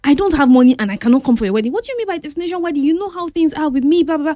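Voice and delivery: fast, high-pitched rant; exasperated protest. Retold or imitated. Retold friend explaining she has no money, exasperated and fast. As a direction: rattle off objections in a rush. Acted quarrel over wedding money.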